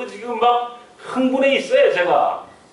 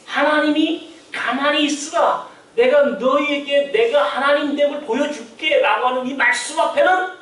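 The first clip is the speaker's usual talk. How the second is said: preaching; 6 semitones higher than usual. Acted. Raised, slowed preaching.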